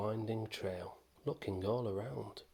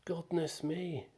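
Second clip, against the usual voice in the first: high, louder dismayed exclamation; aardvark cries out; 8 semitones higher, 3 dB louder than usual. Acted. Aardvark hearing the boy lost his name, high cry. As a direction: gasp it out in sympathy. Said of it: "the aardvark cried".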